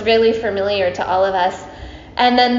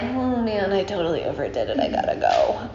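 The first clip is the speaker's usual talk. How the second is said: mock regretful groan; 7 dB quieter than usual. Ganesha realizes he overdid it.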